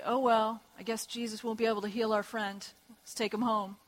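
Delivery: soft, low shrug of giving up; mock resignation. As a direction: imitate friends meekly giving up. Imagined friends giving up easily.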